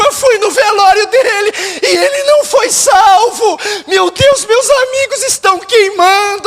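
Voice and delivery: high, loud cry of regret; acted anguish. Believer realising family is in hell, acted anguished wail. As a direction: wail it out as a guilt-stricken mourner.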